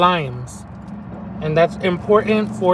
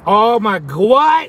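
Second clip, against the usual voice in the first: loud, high disbelief; amazed; 4 semitones higher, 4 dB louder, slower than usual. Real, not acted. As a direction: gasp at the taste. Tasting the birria.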